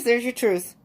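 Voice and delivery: ordinary talk, nothing marked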